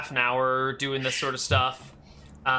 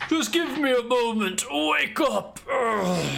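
dopey cartoon bellow; 8 semitones higher than usual. Giant waking a sleeping giant.

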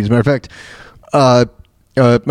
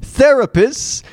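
much higher, slow quizmaster reading; imitating Trebek; 10 semitones higher, much slower than usual. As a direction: read out "Therapist" as a prim host. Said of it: "Trebek would go".

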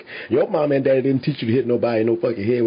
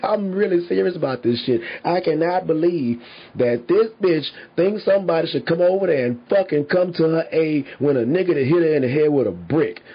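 ordinary talk, nothing marked

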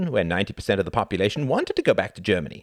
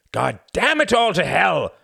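raging curse; 10 semitones higher, 6 dB louder than usual. A narrator's reading. Cuthbert cursing in rage.

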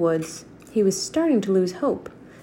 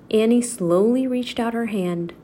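usual speaking voice

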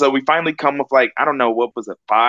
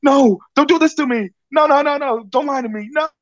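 friend in denial; 13 semitones higher than usual. Retold or imitated. Friend Ethan on the phone refusing to believe the catch, pleading high. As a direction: beg him not to lie, voice way up. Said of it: "And then Ethan's like".

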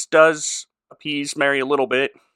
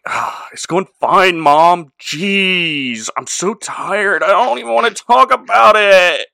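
put-on whiny, drawn-out teen groan, louder and higher; exasperated Napoleon Dynamite whine; Napoleon Dynamite impression; 5 semitones higher, 6 dB louder, slower than usual. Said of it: "Jesus doing a Napoleon Dynamite imitation".

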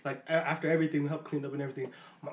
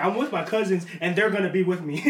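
ordinary delivery

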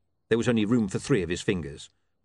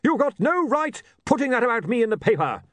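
high, loud indignant protest; angry barbarian; 5 dB louder than usual. Acted. Barbarian confronting the editor with an axe, angry. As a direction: storm in and accuse him.

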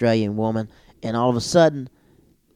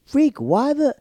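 surprised outburst; 4 dB louder, slower than usual. Retold or imitated. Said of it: "I was like".

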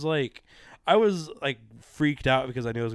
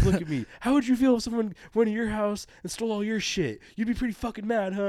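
stern officer; 10 semitones higher than usual. Retold or imitated. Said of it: "But she was like".

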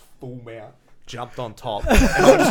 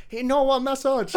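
high, loud mock complaint; put-on high voice, half laughing; imitated indignant masseur; 8 dB louder than usual. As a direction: mimic the masseur calling out to a colleague, laughing. Masseur complaining to the other guy.

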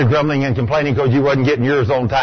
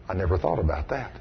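hushed realization; 11 semitones lower, 10 dB quieter than usual. Retold or imitated.

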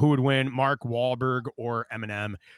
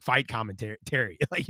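usual speaking voice

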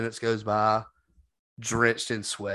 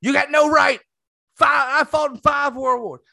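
put-on high, loud ranting old man; angry old veteran; 13 semitones higher, 5 dB louder than usual. Retelling the old man yelling at him, high and loud. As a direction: yell it like a furious old man. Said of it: "He said".

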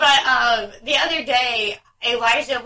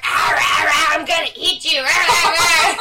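louder mock monster roar; be a toddler playing monster; playful monster growl; 5 semitones higher, 4 dB louder than usual. Little brother chasing big brother, mock monster roar. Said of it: "here comes James".

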